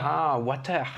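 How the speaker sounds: put-on water said with a light French accent; a French learner repeating the word. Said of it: "with my French accent".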